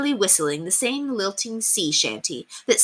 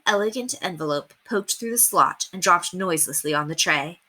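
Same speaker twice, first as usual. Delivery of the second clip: ordinary delivery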